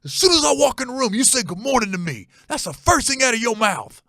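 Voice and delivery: high, loud hammed-up lecturing stepdad; stern stepdad. Stepdad lays down the good morning rule, high mock lecture. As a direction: bark the rule like a petty tyrant. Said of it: "he looks at me, he goes".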